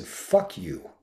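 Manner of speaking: sudden high-pitched snap of anger; angry outburst. Retold or imitated. Friend finally loses it at a self-critical friend, angry outburst. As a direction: blurt it out, losing patience. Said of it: "I just lost it".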